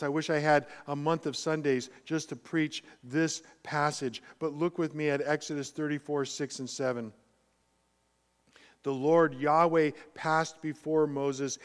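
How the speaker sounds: ordinary talk, nothing marked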